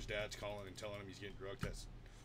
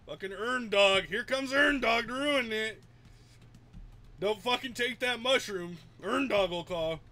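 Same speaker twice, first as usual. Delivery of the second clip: loud, high-pitched announcing; hammed-up loud heads-up; mock warning; 10 semitones higher, 10 dB louder than usual. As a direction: call out a joking alarm at full volume. Mock warning about friend's killjoy dad.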